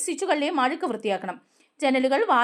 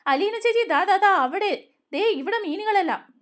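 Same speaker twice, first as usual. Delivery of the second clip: high-pitched excited calls, not a full shout; mild excited clamour; 6 semitones higher than usual. A narrator's reading. Children calling Alina to spot fish, mildly excited clamour. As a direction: lightly act out children calling out. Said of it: "എല്ലാവരും കൂടി ഒച്ചയിടും".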